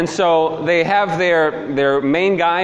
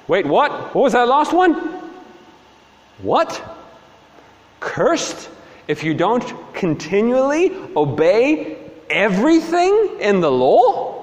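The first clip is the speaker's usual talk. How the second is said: higher, stunned double-take; a startled what-did-you-just-say; shocked disbelief; 5 semitones higher than usual. Acted. Luther reacting to the Levites' last curse, stunned.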